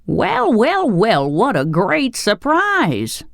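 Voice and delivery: high, drawn-out cry of surprise; loud exclamation. A narrator's reading. Tin Woodman surprised by visitors in the next room, loud exclaiming. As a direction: exclaim it high with stretched-out words. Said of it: "a loud voice in the next room exclaim".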